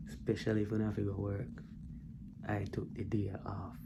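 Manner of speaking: whispered aside. Real, not acted. Dad telling viewers he took the day off, in a whisper. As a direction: let it slip out under your breath.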